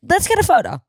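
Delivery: very high, awkward blurt; mock panicked suggestion. Nervous fan wanting out of small talk, mock panicked suggestion. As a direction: squeak it out to escape the conversation. Retelling panicked bid to end chat.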